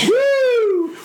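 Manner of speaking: loud, high party whoop; excited whoop. Retold or imitated. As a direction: throw out a wild cheering woo. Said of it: "everyone's like".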